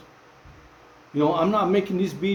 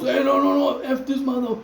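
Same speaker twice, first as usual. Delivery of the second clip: retold angry outburst; 7 semitones higher than usual. Inmate in shower line confronting him, mock angry yell.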